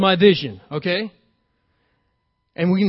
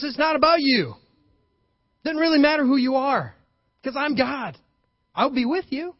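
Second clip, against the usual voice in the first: preaching at full force; 7 semitones higher than usual. Acted. Preacher voicing God, raised pitch.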